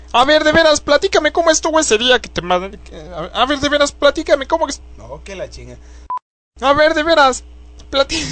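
very high, put-on voice; high character voice. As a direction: squeak it out in a silly high voice. (voz aguda de personaje)

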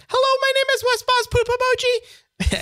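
very high, slowing synthetic voice; high robot voice. Retold or imitated. Showing course students the squeaky text-to-speech, high robot voice. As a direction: pitch it way up like a cartoon computer. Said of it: "In the course, JavaScript thirty, we go".

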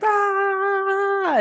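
sudden high shriek; podcast scream. Pretend. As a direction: let out a short excited scream. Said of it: "Me podcast screaming".

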